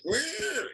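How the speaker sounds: high-pitched swerving "wheer"; very high vocal sound effect; imitated veering noise. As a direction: squeal it like something skidding sideways. Imitates rider veering off bike.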